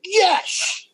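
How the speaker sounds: high-pitched, jubilant exclamation; retold burst of glee; delighted yes. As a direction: celebrate it in one high cheer. Acting out his delighted reaction.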